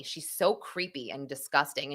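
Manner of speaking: normal talking voice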